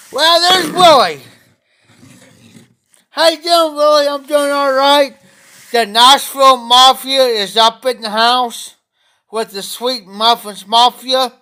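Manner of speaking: excited greeting. Real, not acted. Host greets Willie joining the stream, excited. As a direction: call out a big hello to an arriving friend.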